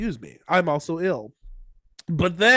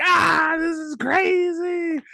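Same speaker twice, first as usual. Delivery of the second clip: high excited shout, slowly drawn out; crowd going wild; 12 semitones higher, much slower than usual. Retold or imitated. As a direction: cheer like a stunned crowd. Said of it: "everyone's like".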